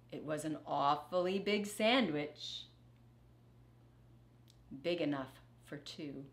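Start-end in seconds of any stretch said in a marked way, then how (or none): none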